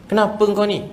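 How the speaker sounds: mock stern father. Father questions son massaging his leg, mock stern. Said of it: "Bapak pula garang".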